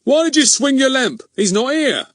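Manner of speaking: high, loud exasperated policeman; exasperated Goon. Acted. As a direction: bark it out, fed-up and baffled.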